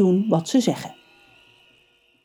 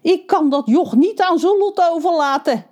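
panting while running; out of breath; 10 semitones higher than usual. A narrator's reading. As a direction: gasp it out of breath. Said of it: "hijgt hij".

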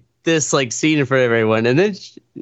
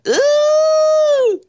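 sudden very high shouted ooh; telling how he snapped awake, with a loud high shouted ooh; startled whoop; 4 dB louder than usual. Retold or imitated. Retold moment of being jolted awake.

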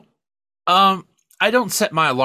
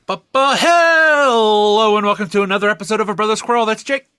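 bright announcer voice opening the episode; podcast host intro; 6 semitones higher, 4 dB louder than usual. Acted. Host opening the episode for listeners, upbeat announcer voice. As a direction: kick off the show like a host.

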